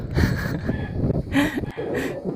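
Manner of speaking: a high airy burst of laughter; high breathy laugh. Real, not acted. Laughing at his own joke.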